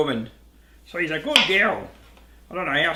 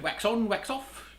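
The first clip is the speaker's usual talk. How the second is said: kung fu master quote; 6 semitones higher, 6 dB quieter, slower than usual. Retold or imitated. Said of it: "what's that little kung fu dude?".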